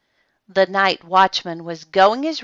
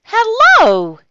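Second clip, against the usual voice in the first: high, loud, drawn-out hello; watchman greeting; 10 dB louder than usual. A narrator's reading. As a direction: sing out a surprised greeting. Watchman finds bear under covers.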